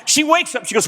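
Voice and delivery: ordinary talk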